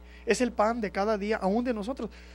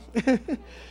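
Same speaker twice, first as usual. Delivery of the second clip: amused higher-pitched chuckle; short run of chuckles; 3 semitones higher than usual. Real, not acted. Preacher laughing along with his congregation, amused chuckle.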